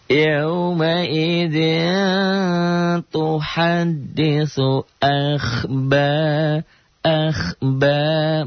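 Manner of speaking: slow, high chanted tilawah; Quran recitation. Shown as a demonstration. Teacher reciting the verse for the class, slow chant. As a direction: draw the words out melodically.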